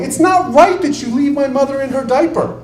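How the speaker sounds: high, loud whiny protest; mock complaining relative. Relative pleading with a nursing home owner, hammed-up whine. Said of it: "running around going".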